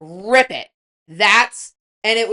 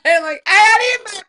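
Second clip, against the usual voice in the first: excited; 11 semitones higher, 5 dB louder, much faster than usual. Real, not acted. Excited fast retelling.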